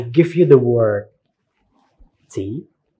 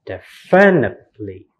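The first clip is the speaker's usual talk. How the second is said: misplace the stress: de-FI-nitely in "definitely"; wrong second-syllable stress. Shown as a demonstration. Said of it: "It's not in second syllable".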